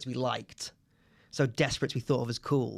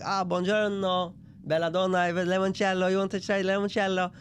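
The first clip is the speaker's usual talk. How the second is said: put-on Italian accent; 6 semitones higher than usual. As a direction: play the eager Italian landlord. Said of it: "very Italian man".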